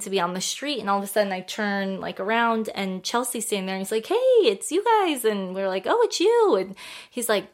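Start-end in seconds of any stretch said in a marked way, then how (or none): 4.1-5.2 retold delighted hail; excited greeting. Retold friendly greeting on street. 5.9-6.6 imitating a delighted group reply; retold happy recognition, pitch way up; excited greeting. Retold greeting back to him.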